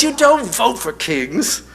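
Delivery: exasperated explanation. Retold or imitated. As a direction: explain the obvious with high exasperation. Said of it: "he explains".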